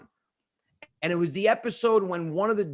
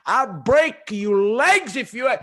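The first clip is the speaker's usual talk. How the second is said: slow, louder rising threat; father working himself up, slow and louder; angry threat; 5 semitones higher, 7 dB louder, much slower than usual. Retold or imitated. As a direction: drag out the threat as anger builds. Father getting himself mad.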